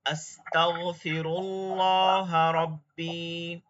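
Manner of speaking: slow, careful recitation of the dua. Shown as a demonstration. Teacher reciting the repentance dua to the class. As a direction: recite it slowly and piously.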